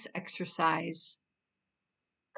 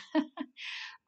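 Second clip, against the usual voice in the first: light, breathy little laugh; short chuckle; 10 semitones higher, 5 dB quieter than usual. Real, not acted. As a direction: chuckle softly to yourself. Chuckles after listing clients' surface emotions.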